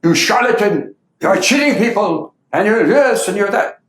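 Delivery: high, indignant accusations hurled; retold shouts of abuse, pitched high; mock abusive shouting. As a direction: throw insults like an angry heckler. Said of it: "they started shouting abuses at him".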